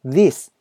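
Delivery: "this" with the tongue tip lightly between the teeth; TH sound. Shown as a demonstration. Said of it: "舌先を軽く噛んで発音するなど". (「this」は舌先を軽く噛んで)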